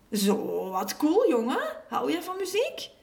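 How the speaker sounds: high, lively enthusiasm; impressed, rising into an eager question; delighted. A narrator's reading. Neighbour hears of the homemade drum kit, delighted.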